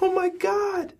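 high-pitched, acted shock; game master playing a horrified woman; shocked gasped line. GM voices woman seeing car.

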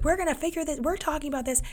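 high mock mom insisting; retold mom, voiced high; imitating insistent mom. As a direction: play Mom chasing her kid up the stairs, pitched high. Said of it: "and be like".

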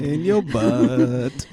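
very slow, stretched-out words; mock-dramatic drawl; teasing echo. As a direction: drag each word out playfully. Co-host playfully echoes crude phrase.

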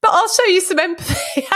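giggling as she delivers the punchline; laughing. Real, not acted. Laughing while voicing son's attitude.

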